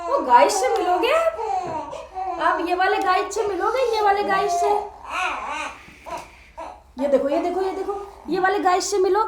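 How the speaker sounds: high, sing-song cooing to an infant. Real, not acted. Mother cooing to crying baby.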